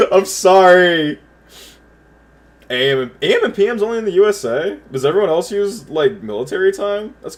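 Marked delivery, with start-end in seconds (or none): 0.1-1.2 louder, higher, drawn-out sorry; laughing apology. Real, not acted. Apologizing for throwing up on Sam, amused and loud. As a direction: stretch the apology, fresh from cracking up.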